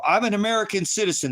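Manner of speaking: high, loud, desperate plea; a mock cry of protest from a detained person; imitating a detainee. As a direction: mimic someone crying out their citizenship. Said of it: "You hear them".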